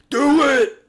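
high, loud mock shout; hammed-up shouted command; acted yell. Acted monster or victim cry.

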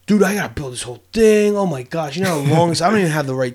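acted-out grumbling at a big job; mock complaining. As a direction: play up the overwhelmed whine a little. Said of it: "complaining in my head".

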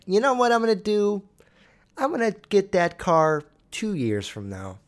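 a touch of a smug, overly careful lottery winner; mocking a cautious winner. Vlogger mocking a billionaire who waits two years, light mock voice. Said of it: "and then be like".

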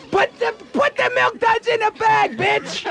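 high-pitched, hammed-up angry shout; mock outraged demand. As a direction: demand the candy in comic outrage. Acts thwarted overage trick-or-treater demanding candy.

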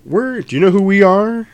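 high, loud menacing question; imitating Jules. Jules confronts Brett, high and loud with menace. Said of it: "and he's like".

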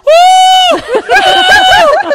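huge high-pitched comic scream of dismay; hammed-up, drawn-out shriek; horrified scream. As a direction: scream it out in mock panic. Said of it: "And I just go".

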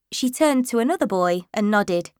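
plain reading voice

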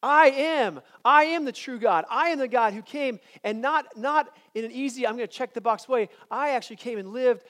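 loud, high rising I am declarations; preacher voicing Jesus's I am, building; preaching at full force. Real, not acted. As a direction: build the proclamation to a peak.

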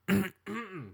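pointed throat clear; fake cough. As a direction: clear your throat knowingly. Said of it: "That cough would suggest that I was lying".

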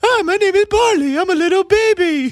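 put-on very high baby-puppy squeak; little puppy falsetto; dog voice. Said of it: "What's your dog voice".

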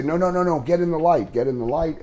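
urging director. Retold or imitated. Director urging the actor to continue, high and eager. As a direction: hurry him back into the light.

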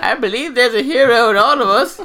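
high-pitched, loud put-on British-tinged old lady voice; mock Aunt May, way up high and accented; Aunt May impression. Friend hams up Aunt May's line to tease the group, high and loud. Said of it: "Stop giving Aunt May a fucking accent".

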